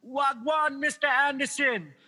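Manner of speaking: high-pitched Jamaican patois greeting; Jamaican accent. Retold or imitated. FedEx driver greeting a customer, Jamaican accent. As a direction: hail a friend in an imitated Jamaican accent. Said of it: "the Jamaican guy goes".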